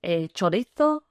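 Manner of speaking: mild put-on Spanish accent; lightly hammed-up Spanish accent. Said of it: "in that Spanish accent".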